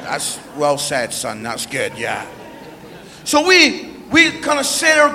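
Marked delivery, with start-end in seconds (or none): 0.0-2.2 low, quiet backing down; subdued concession. Retold or imitated. Dad conceding to his blunt son, subdued concession. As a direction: give in softly, pitch dropped right down. Dad's deflated reply to son.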